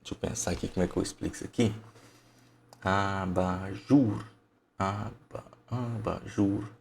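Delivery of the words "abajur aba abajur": slowly sounded-out word; sounding out syllables. Shown as a demonstration. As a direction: stretch each syllable of Abajur, thinking aloud.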